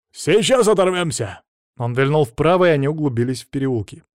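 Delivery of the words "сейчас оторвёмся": high, rousing driver's cry; excited shout. A narrator's reading. Driver promises to lose the pursuers, shouting. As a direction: call it out high and confident. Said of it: "крикнул водила".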